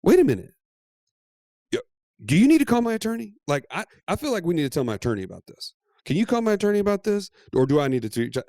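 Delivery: high, fast and eager, a gush of sudden importance; mock power-tripping wife; giddy excitement. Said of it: "She's like".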